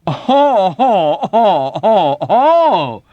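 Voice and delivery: high, loud laughing oh oh oh; swelling merriment. Acted. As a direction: laugh it up indulgently. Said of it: "indulgent merriment swelled tremendously".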